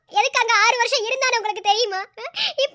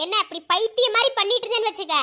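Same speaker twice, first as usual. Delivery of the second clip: ordinary talk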